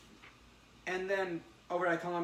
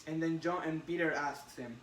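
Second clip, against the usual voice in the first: normal talking voice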